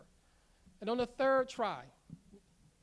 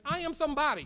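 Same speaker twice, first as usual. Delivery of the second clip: loud, higher rallying call; from the gut; 5 semitones higher, 8 dB louder than usual. Shown as a demonstration. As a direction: lead a crowd chant. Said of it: "hear it from your gut".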